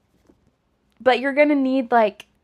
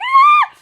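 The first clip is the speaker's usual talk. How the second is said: piercing high loud scream; retold shriek, loud and high; 10 dB louder than usual. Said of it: "Then I screamed".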